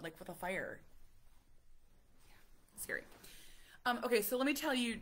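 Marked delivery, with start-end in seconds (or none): 2.3-3.0 hushed worried whisper. Real, not acted. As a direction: breathe it out as a whisper. Quiet aside about danger.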